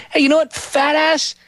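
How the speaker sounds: high-pitched, exasperated telling-off; fed up, scolding an absent pitchman; indignant rant. Acted. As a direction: rant upward in comic outrage. Mock rant at charity pitchman.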